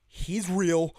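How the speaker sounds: shouting a warning about the gargoyle; startled cry. Retold or imitated. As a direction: blurt it out in alarm. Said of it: "my version of this interaction".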